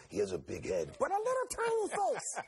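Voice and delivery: a hint of [name], jumping way up at the end. Comedian mocking [name], ending very high. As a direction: squeak out the last words. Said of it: "[name] ... I go".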